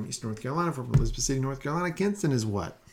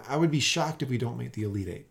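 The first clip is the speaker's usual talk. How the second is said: normal talking voice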